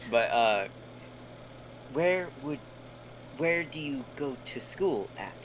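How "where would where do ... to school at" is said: a touch higher, tentative in-character question. Acted. Player asking another character about school, in character. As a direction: ask slowly as your character. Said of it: "I'll do it in character".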